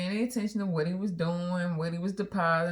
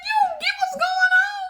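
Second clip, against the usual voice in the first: much higher, louder; mock scolding; 7 dB louder than usual. Wife scolds husband in the car, much higher and louder. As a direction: scold him, exasperated.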